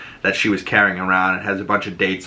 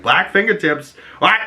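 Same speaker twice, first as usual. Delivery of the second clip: mock surprise; 8 semitones higher than usual. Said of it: "But I was like".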